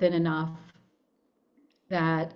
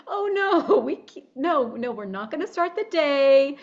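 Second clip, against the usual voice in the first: stopping herself; 7 semitones higher, 4 dB louder than usual. Retold or imitated. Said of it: "I'm like".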